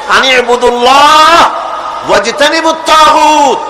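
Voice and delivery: preacher's ringing proclamation; proclaiming shout. Real, not acted. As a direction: call the Arabic out forcefully, stretching the phrase. Preacher proclaims the verse just chanted.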